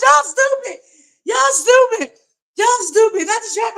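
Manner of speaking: very high mocking squeal; shrill-pitched teasing singsong. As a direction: squeak it out, taunting. Mocking letter's hidden message.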